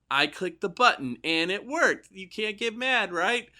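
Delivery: put-on high, slow, wide-eyed kid voice; innocent child. Kid explaining the click to a parent, high and innocent. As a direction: play the innocent six-year-old. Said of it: "where they'll go".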